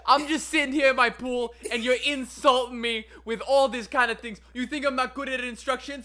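high, loud indignant whine; offended dolphin. Acted. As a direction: protest like a wounded dolphin.

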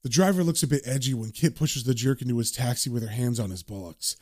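low, subdued, grim recollection; haunted comic-book narrator. Acted. Reads Constantine's narration.

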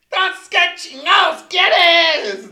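high, loud, very slow mock exasperated yell; Eugenio Derbez impression. Friend mocks the guy answering Rose, exasperated high yell. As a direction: bellow it drawn out like an outraged comedian. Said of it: "dijo Eugenio Derbez".

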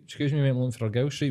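put-on "Excuse me, mate, I'm looking for a girl street" rattled off fast and slurred; fast mumbled mock driver. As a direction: gabble the question like a rushed stranger. Same quote, rushed mock take.

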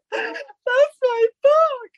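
high, slow, tearful wail; sobbing. Retold or imitated. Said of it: "standing there sobbing".

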